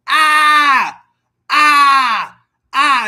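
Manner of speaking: put-on high, loud, pained child screams; imitating son's screams. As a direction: ape the kid yelling after stubbing his toe. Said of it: "starts screaming".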